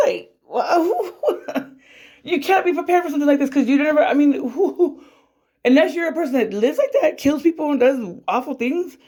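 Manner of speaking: high, laughing disbelief; incredulous nervous laughter. Real, not acted. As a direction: laugh through the shock.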